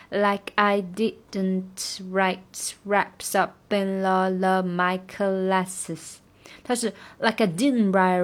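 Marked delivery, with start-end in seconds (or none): 0.1-6.2 very slow, uneven rhythm; slow rhythm demo. Shown as a demonstration. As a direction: stretch cla, slow all through. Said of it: "它不是很均匀的分配". (慢速，节奏不均匀)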